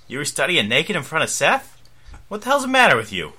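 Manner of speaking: high, fast, incredulous scolding; shocked. Acted. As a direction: sputter it out, appalled.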